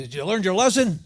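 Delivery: loud, scolding question; stern demand. Acted. Owner confronting the frozen parrot, stern demand. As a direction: demand it loudly like a fed-up owner.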